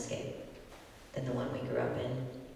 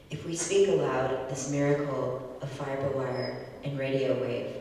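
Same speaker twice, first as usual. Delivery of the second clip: louder, earnest spoken-word delivery; open mic poet; 5 dB louder than usual. Acted. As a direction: perform it like an open mic set. Poet performs poem after intro.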